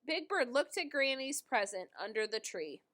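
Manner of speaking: ordinary delivery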